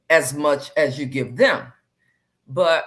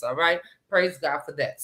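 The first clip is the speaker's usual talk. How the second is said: ordinary delivery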